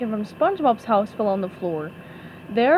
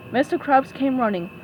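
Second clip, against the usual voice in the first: usual speaking voice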